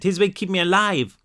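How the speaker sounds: put-on high, distressed French-accented girl; frightened French child, pitched high; broken English, French accent. A narrator's reading. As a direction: stumble through French-accented English, pleading. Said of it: "she said in broken English".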